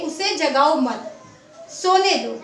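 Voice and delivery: slow उसे जगाओ मत, a short break, then सोने दो; pause after मत. Shown as a demonstration. Teacher showing students where to pause briefly, pause after मत. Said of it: "उसमें हम थोड़ा सा रुके".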